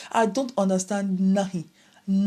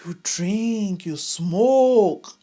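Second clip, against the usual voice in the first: mock shock; 2 semitones higher, 5 dB louder, much slower than usual. Shocked reaction back home.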